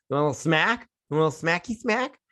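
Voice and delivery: high, rising teasing lilt; =cutesy mock warning of a smack; playful threat. Viewer warning the whiny son, playful threat.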